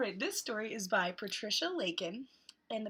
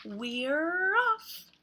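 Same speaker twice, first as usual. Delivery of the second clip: loud, a bit higher; eager; 6 semitones higher, 9 dB louder than usual. Acted. The friends setting off on bikes, loud and eager. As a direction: call it out as they ride off.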